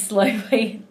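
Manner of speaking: ordinary talk, nothing marked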